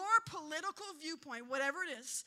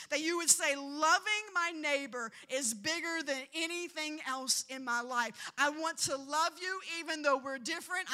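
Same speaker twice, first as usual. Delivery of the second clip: louder, driving sermon peak; full-force preacher climax; climactic preaching; 5 dB louder than usual. Acted. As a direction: build the altar call with fire.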